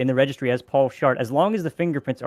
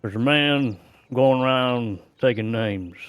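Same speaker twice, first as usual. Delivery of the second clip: [name] line; 3 dB louder, slower than usual. Retold or imitated. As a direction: ease into a slow [name] delivery. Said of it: "[name] song line".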